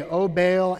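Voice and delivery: a loud pleading invocation; pagan cry to Baal. Retold or imitated. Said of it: "Here's what they say".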